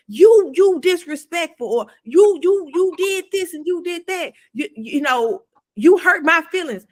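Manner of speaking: jab each you at them; accusing you statements. Retold or imitated. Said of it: "I used to always say".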